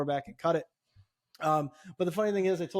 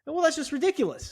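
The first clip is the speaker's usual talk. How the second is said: much higher, indignant cry; exasperated outburst; 12 semitones higher than usual. Retold or imitated. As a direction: throw up your hands in disbelief. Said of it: "now I'm just really agitated".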